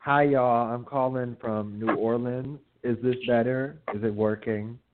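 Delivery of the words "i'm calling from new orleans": usual speaking voice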